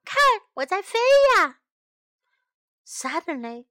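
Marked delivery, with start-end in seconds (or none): 0.1-1.6 high, loud, fast happy shout; shouting with joy. Acted. May running down the hill, loud joyful shout. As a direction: cry it out gleefully. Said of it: "May喊道".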